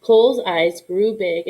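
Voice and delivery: plain reading voice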